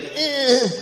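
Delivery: high nasal grunt twice; grunting noise. Retold or imitated. Imitating the Walmart lady grunting, high grunts. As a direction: mimic her grunt. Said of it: "She's literally making that noise".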